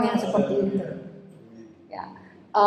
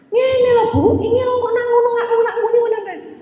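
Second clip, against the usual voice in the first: put-on much higher and louder, an eager kid insisting in Javanese, ending in a laugh; insistent Javanese child; 10 semitones higher, 4 dB louder than usual. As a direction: play the stubborn little pupil pushing his way. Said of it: "bahasa Jawa yang lucu sih". (logat Jawa anak kecil, tinggi dan ngotot)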